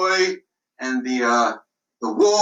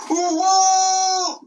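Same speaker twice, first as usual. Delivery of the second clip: high shouted alarm; audience-style shout of "A wolf!"; cry out; 12 semitones higher, 3 dB louder than usual. Shown as a demonstration. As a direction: shout it back as the crowd would. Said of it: "Let me hear you do that".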